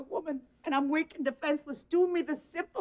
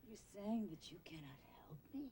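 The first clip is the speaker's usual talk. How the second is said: hushed, breathless disbelief; stunned whisper; 7 semitones lower, 21 dB quieter than usual. Acted. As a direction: whisper it back in shock. Stunned echo of refusal.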